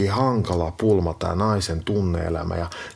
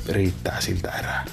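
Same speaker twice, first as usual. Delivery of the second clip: whispered wisdom; 2 semitones lower than usual. Acted. Host whispering love advice to Kesa.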